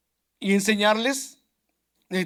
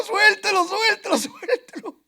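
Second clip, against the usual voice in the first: high, laughing cries of let go; frantic plea; 10 semitones higher than usual. Retold or imitated. Man pulling his pitbull off a dog, laughing pleas. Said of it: "Y yo le pegaba y le pegaba".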